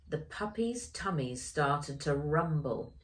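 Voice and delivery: ordinary delivery, nothing marked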